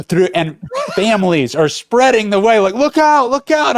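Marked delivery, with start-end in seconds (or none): 2.7-3.8 high urgent shout, running; calling out. Retold or imitated. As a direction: shout a warning while sprinting. Yelling to clear families.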